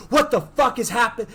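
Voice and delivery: high, loud imitation of panic; acted freak-out. She panics mid-kiss, high retold freak-out. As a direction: yell it as if suddenly freaking out. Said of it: "she freaks out again and goes".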